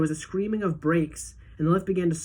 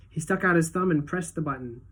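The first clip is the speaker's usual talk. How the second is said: usual speaking voice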